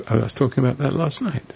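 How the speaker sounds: usual speaking voice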